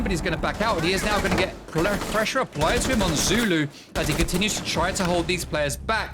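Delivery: energetic esports caster calling the action; brisk match commentary; live play-by-play. Acted. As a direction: call the round as it unfolds. Caster calls the round.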